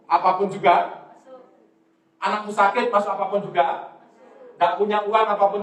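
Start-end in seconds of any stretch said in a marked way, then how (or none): none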